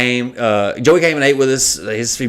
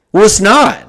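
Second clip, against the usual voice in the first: exasperated retort; 8 semitones higher, 6 dB louder than usual. Retold or imitated. Arguing with the Uber driver.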